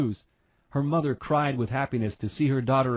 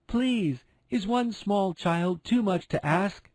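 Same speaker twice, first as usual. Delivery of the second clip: higher, slow pleading voice; pleading wish; 6 semitones higher, slower than usual. A narrator's reading. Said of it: "she pleaded to the stars".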